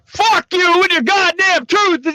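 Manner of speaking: high, loud slow screamed fury; fake mad. Streamer faking rage about truth in sentences. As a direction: scream it as a staged outburst. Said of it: "I can pretend to be mad".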